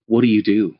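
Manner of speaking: ordinary talk, nothing marked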